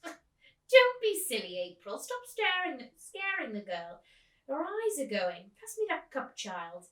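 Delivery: put-on loud, slow, scoffing old lady; old woman scolding her friend, scoffing. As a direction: brush her friend off with a snort. Miss Forcible scoffs at April.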